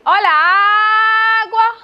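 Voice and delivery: loud, high call stretching the "á" in "água". Shown as a demonstration. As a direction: call "água" out with a long first syllable. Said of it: "Chame, chame".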